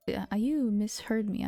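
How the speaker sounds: normal talking voice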